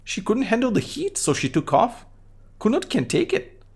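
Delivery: put-on high-pitched mouthy kid voice; Kuno voice. Kid Kuno mocks the cop, high bratty kid voice. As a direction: voice a rude little brat up high, talking about himself in third person. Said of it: "What is wrong with your high voice?".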